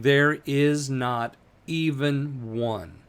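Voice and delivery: slow, weighted "There is not even one"; emphatic. Shown as a demonstration. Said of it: "David emphasizes that by saying".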